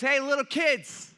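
high, loud drawn-out call from the shore; hammed-up shout across the water; yelling out. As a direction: holler slowly out to men on a boat. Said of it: "who starts yelling out to him".

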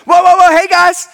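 high, loud burst of excitement; overexcited angel calling out to get attention; pumped angel. Acted. Pumped angel hailing Jesus' followers at the tomb, excited. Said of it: "this angel is pumped".

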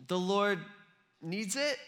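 halting, questioning delivery; mock tentative answer; unsure excuse. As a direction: offer it haltingly, rising into a question. Disciple unsure of the scripted excuse.

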